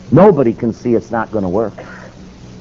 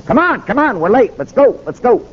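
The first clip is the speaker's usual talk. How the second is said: urgent hurry; 11 semitones higher than usual. Retold or imitated. Preacher hurrying him at dawn.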